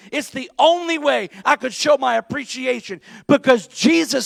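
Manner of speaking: loud, high, fervent declaration; a woman pouring out loud love for Jesus; impassioned devotion. Acted. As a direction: preach Mary's heart out at full force. Preacher voicing Mary's devotion.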